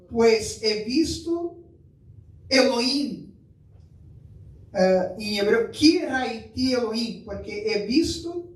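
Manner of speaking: usual speaking voice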